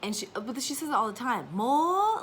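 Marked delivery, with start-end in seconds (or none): 1.5-2.2 put-on high, loud toddler demand; imitating a toddler asking for more; toddler saying more. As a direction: chirp it like a tiny toddler. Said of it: "the way that she just says words".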